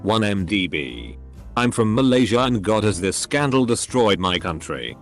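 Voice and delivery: plain reading voice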